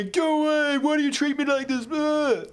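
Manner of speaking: put-on high, wailing teen protest; mock teenage tantrum. Teenager telling parents to leave, imitated high whiny tantrum. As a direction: whine it like a put-upon teenager. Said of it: "had my parents said that to me".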